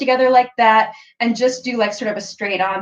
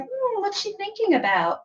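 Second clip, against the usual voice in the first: mysterious musing; 10 semitones higher, 6 dB quieter than usual. Shown as a demonstration. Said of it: "So it's like".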